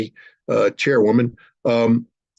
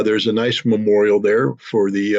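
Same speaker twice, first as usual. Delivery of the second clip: ordinary talk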